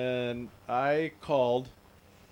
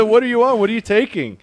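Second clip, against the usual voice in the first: higher, louder retold demand; loud incredulous questioning; 7 semitones higher, 8 dB louder than usual. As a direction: press him for what he took. Demanding what friend took, retold.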